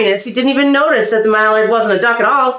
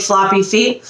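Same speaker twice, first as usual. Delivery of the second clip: plain reading voice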